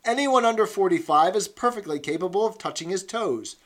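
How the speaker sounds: loud, high, rousing encouragement; encouraging. Acted. Instructress urging the comrades to keep fit, loud and encouraging. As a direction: pump up the squad to keep fit. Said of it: "she added encouragingly".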